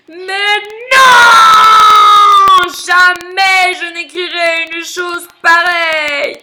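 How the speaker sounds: high, loud roar of rage, long drawn out; furious shout. Acted. Lion hears the dung beetle's letter, furious shout. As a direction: bellow in outraged refusal. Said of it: "Fou de rage".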